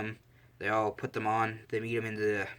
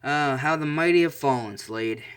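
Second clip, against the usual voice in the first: louder, raised mocking greeting; mocking taunt; 3 semitones higher, 7 dB louder than usual. Bodyguard answers his old enemy's call, taunting him loudly. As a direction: gloat at a beaten rival.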